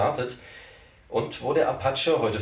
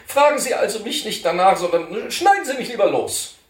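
put-on fussy old cantor; 12 semitones higher, 8 dB louder than usual. Cantor correcting Poller about his title, high and fussy. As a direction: insist on your proper title, prissily.

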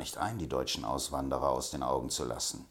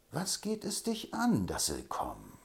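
ordinary delivery

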